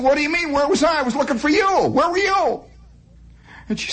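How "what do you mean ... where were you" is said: put-on indignant kid. Kid self answers back indignantly.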